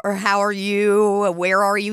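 put-on lightly slurred drunk come-on; slurred drunk imitation. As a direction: stretch the words like a drunk. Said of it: "guy is clearly inebriated".

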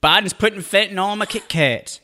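high-pitched Southern mock outrage; hammed-up Southern conspiracy nut; put-on Southern accent. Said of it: "I love doing the Southern accent".

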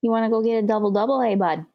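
quick, put-on Canadian accent; hammed-up Canadian accent; mock Canadian accent. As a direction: rattle it off like a Canadian buddy. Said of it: "throw that accent on words".

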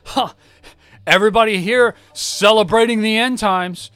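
high, loud end-times proclaiming; mock doomsday coworker. Johnny warns break room, loud preachy doom voice. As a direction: announce doom like a street preacher. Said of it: "Johnny walks in and goes".

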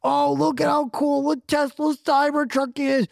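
high, slow mocking gush; mocking fanboy quote. S1 mocking Cybertruck fans, high gushing voice. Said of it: "I'm tired of everyone being like".

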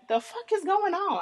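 high, baffled question; confused shock. Retold or imitated. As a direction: pitch up in bewilderment. Teen self confused at party.

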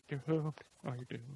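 a hint of a droning prophet; doom prophet. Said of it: "while he just goes".

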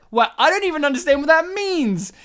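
high-pitched comic exasperation; worked-up, high mock outrage; incredulous rant. Real, not acted. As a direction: protest in escalating disbelief. Mock outrage at co-host's excuse.

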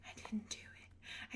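slow, hushed mock admission; whispered confession. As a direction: whisper it as if owning up. Confessing failure to sister, retold.

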